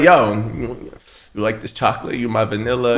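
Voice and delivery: mock dirty talk. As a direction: sweet-talk her, half joking. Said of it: "you could be like".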